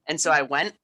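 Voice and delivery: ordinary talk, nothing marked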